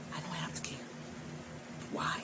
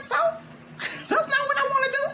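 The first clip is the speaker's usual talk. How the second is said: high, louder laughing protest; mock defiant whine; 5 dB louder than usual. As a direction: toss it back high and bratty. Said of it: "I'm like".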